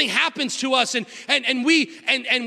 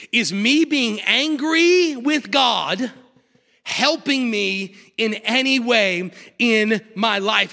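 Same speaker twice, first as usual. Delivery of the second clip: slow reflective question; much slower than usual. Real, not acted.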